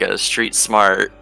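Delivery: ordinary delivery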